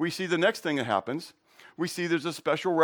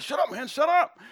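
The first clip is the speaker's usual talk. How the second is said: 1 nervous friend; 10 semitones higher than usual. Retold or imitated. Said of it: "He said".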